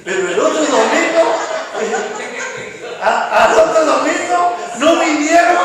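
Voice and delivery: loud laughing punchline. Real, not acted. Pastor laughing loudly as he tells his congregation. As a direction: sell the joke with a big laughing lift.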